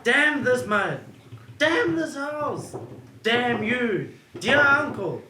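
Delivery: David cursing the mud and house, furious; angry cursing. Acted. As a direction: spit out each damn. David cursing in audio drama.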